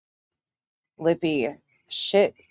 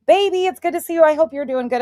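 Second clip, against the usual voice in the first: tender greeting; 8 semitones higher, faster than usual. Real, not acted.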